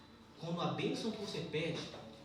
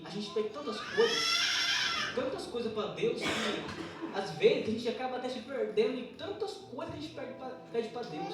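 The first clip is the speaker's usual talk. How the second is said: higher, raised sermon voice; fervent, high-pitched church exhortation; impassioned preaching; 7 semitones higher, 6 dB louder than usual. Real, not acted. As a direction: exhort the congregation with rising urgency.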